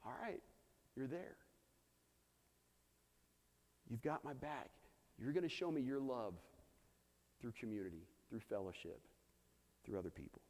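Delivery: soft, halting prayer with long pauses; quietly surrender, understated, no sobbing; retold tearful prayer. Man retelling his prayer to God, softly moved. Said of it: "sitting there crying".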